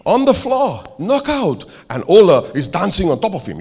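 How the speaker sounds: put-on high-pitched hammy Norwegian accent; bad Norwegian accent. Norwegian manager orders second picture, bad Norwegian accent. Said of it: "I'm doing a very bad accent".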